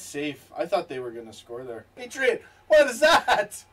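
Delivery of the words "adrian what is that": laughing. Real, not acted. Laughing at a funny clash.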